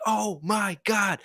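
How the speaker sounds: high, slow, drawn-out gasp of horror; retold panic. Watching two planes head for each other, slow high horror. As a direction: stretch it out high in disbelief. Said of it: "I'm freaking out. I'm like".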